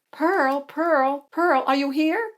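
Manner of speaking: high, drawn-out searching call. Acted. Hattie looking for Pearl at the theater, calling out. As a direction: call for someone hidden somewhere.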